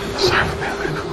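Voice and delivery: breathy whisper, giving in; whispered submission. Retold or imitated. Woman repeating submissively.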